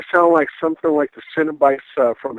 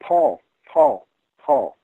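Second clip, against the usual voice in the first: ghostly woman calling; much slower than usual. Retold or imitated. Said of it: "a woman's voice go".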